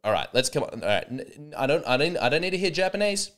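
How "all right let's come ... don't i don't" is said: ordinary delivery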